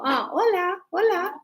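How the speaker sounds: a bright, high, cheery "hola"; lightly playful greeting. Retold or imitated. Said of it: "so you go".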